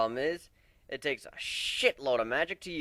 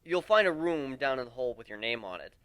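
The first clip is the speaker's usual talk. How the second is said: usual speaking voice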